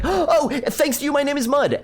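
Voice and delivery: high, eager blurt of the punchline; imitating a kid blurting the punchline; excited kid. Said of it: "so I was all excited".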